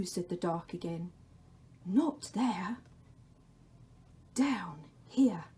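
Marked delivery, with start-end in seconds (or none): 1.9-5.5 the Dark's whisper. Acted. The Dark calls to Laszlo in a whisper. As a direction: breathe his name from the shadows.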